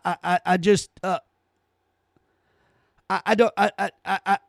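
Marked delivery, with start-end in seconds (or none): none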